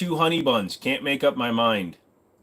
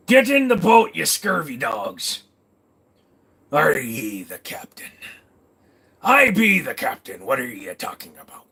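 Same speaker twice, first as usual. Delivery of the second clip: pirate speak. Acted. Pirate captain orders the scurvy dogs, mock pirate voice. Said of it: "a pirate speak".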